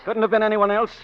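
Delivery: high, loud, certain; heated insistence. Acted. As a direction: shut down her denial.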